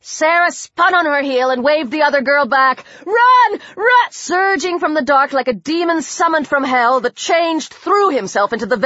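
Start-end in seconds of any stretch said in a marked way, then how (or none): 3.1-4.1 urgent high shout. Acted. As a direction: yell the warning with full force. Sarah yells warning to Tori.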